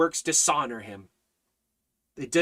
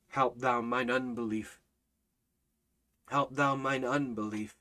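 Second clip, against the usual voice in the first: lower, softer, a hushed prayer; soft prayerful plea; 3 semitones lower, 7 dB quieter than usual. Acted. Preacher prays the father's words quietly for the church, hushed. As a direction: murmur it like a humble prayer. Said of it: "if we would just learn to cry that".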